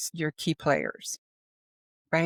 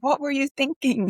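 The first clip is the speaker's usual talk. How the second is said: brash boss yell; 9 semitones higher than usual. Retold or imitated. Brash New York boss scolding a worker who messed up, mock yell. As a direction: hammer the employee with it like an irritated boss. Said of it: "a kind of a brash New Yorker".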